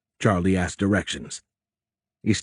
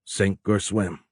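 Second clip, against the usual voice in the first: plain reading voice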